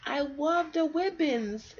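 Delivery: put-on high, slow little girl, delighted; a small girl, drawn out with joy. Allie loving the radio, high and slow delighted girl.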